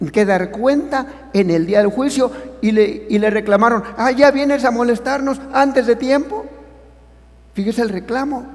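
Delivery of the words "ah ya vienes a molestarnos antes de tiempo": high, mocking complaint of the demons. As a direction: sneer it as the demons. Reclamo de demonios otra vez. (los demonios reclaman, agudo y burlón)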